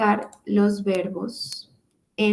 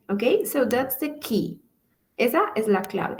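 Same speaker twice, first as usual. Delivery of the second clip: usual speaking voice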